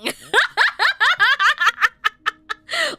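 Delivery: high, loud helpless laughter; laughing fit. Real, not acted. Reader losing it over her French accent, real laughter. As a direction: crack up at your own silliness.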